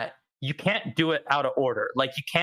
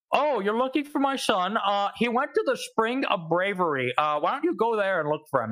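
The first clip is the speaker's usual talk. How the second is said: lightly voiced king, higher pitch easing down; retold king; 7 semitones higher than usual. King sending the player after his son, lightly voiced. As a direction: imitate a king, pitched up at first. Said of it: "then the king's like".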